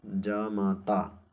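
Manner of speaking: जामाता very slow, every long vowel held; drawn-out syllables. Shown as a demonstration. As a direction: spell out जामाता slowly as jaa-maa-taa. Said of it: "जामाता होता है".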